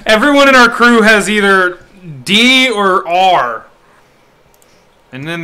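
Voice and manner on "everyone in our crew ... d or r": loud announcement. Real, not acted. Teasing crew name letters.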